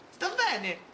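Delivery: high cartoon voice saying she's okay; character voice. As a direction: pipe up brightly that she's fine. Voicing character in dialogue.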